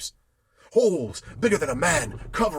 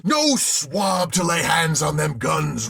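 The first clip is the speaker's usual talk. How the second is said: loud, slow roar; 4 semitones higher, 6 dB louder, slower than usual. A narrator's reading. Captain forbidding the guns, slow roar. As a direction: roar each word down. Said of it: "roared the captain".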